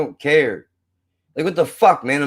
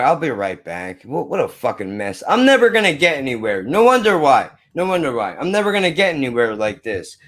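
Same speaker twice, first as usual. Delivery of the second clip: ordinary delivery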